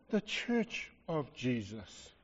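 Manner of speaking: soft slow drop. Real, not acted. Sudden drop after climax.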